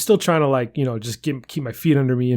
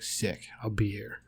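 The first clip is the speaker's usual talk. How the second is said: whispered aside; 4 semitones lower, 14 dB quieter, slower than usual. Retold or imitated. Retelling his reply at the gym.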